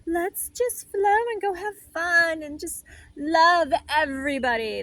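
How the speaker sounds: hammed-up blissful spirit inviting everyone to have fun; calm spirit voice. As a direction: float it out like a carefree free spirit. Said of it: "one's real calm and just be like".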